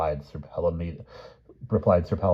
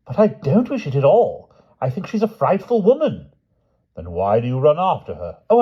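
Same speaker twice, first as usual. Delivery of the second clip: higher, louder voiced quarrel of two old knights; knights bickering; 7 semitones higher, 7 dB louder than usual. Grumor and the king argue over the letter, higher and loud. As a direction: bluster back and forth.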